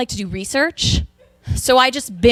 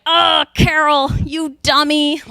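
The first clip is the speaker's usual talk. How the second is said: PG-thirteen cursing; 5 semitones higher, slower than usual. Acted. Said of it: "in P G thirteen language".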